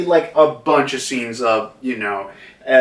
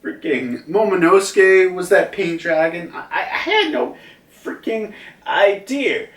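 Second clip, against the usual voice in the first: higher, disbelieving mock surprise with a laugh; stunned crowd; 7 semitones higher than usual. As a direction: blurt it out like a stunned bystander. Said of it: "everyone is just reacting to that, like".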